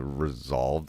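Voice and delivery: ordinary delivery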